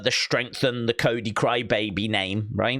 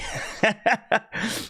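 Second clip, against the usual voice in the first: short laugh; 9 semitones higher than usual. Real, not acted. Laughing while quoting the promo.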